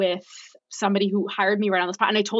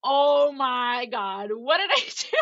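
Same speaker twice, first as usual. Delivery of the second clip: dawning panic; 4 semitones higher, slower than usual. Retold or imitated. Retelling her moment of panic.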